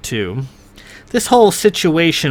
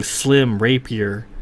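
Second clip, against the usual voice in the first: usual speaking voice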